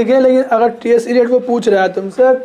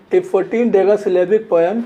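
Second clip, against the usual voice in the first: slow dictation; 3 semitones lower, slower than usual. Shown as a demonstration. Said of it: "ऐसे कर लेते हैं".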